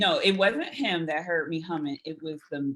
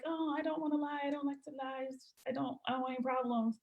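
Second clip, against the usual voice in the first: timid punk; 8 semitones higher, 6 dB quieter than usual. Retold or imitated. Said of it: "So me, I'm a punk".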